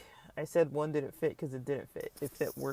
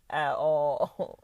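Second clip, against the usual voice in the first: yawning; 6 dB louder than usual. Real, not acted. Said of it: "I'm, like, yawning".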